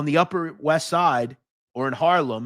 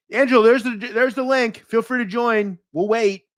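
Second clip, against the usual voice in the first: taunting; 8 semitones higher, 4 dB louder than usual. Real, not acted. Taunting commenter with a link.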